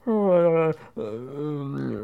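put-on drawn-out mumbled English-accent noises; mock English accent. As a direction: imitate an English accent with slow, wordless vowels. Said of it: "il a un accent anglais, il fait".

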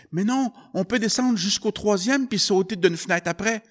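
high, loud urgent protest; pleading boy. Acted. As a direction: argue back fast and high. Boy argues, higher voice. (garçon qui insiste, voix aiguë et forte)

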